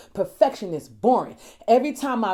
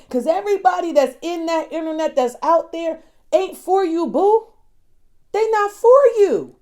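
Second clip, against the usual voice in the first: forceful tough-love admonishment; emphatic warning; 7 semitones higher, 7 dB louder than usual. Real, not acted. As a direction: drive it home in a raised voice. Emphatic warning about internet people.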